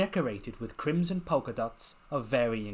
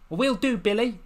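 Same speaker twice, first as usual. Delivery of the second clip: bright and much higher, a chirpy goodbye; cheery farewell; 10 semitones higher, 6 dB louder than usual. Acted. Lucy waving Bill off with a chirpy goodbye. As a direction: sing it out as you wave.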